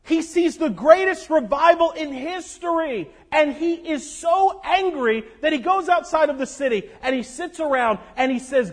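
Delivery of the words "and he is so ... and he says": ordinary talk, nothing marked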